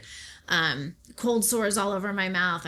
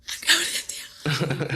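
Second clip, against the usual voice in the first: slow, breathy whisper of realisation; awed whisper; 4 semitones lower, slower than usual. Retold or imitated. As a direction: whisper it as if it just dawned on you. Sudden realisation at the meeting.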